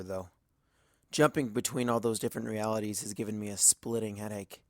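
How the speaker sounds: ordinary delivery, nothing marked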